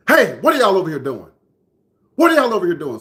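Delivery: accusing store man, acted out; retold challenge. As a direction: call out like you've caught kids at it.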